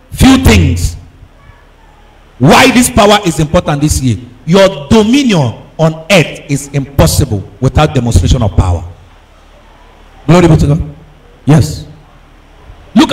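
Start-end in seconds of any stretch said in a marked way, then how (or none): none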